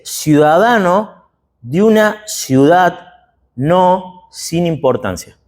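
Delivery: slow, weighty pride; proud, deliberate. Acted. Paul states his citizenship to the commander, slow and proud. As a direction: let each word land slowly. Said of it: "Y fíjese cómo dice".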